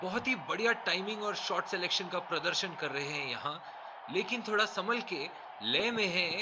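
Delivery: hushed word of caution; quiet commentary. Acted. Cautious aside on the batsman.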